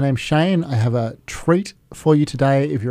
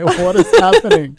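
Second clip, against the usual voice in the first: high, loud, alarmed what; startled outcry; 8 dB louder than usual. Real, not acted. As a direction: cry out in mock alarm. Host alarmed by an office event.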